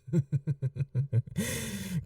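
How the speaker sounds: soft amused laugh under the breath; quiet chuckle. Acted. Vampire eyeing the hunter's necklace, quiet chuckle. Reacting to the hidden charm.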